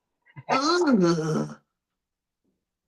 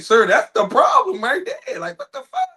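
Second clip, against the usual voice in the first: high squeaky disbelief; a doctor losing it over the patient; incredulous doctor. Retold or imitated. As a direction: shriek it like a shocked doctor. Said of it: "You be like".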